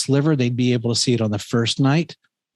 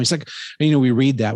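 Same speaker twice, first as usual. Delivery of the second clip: normal talking voice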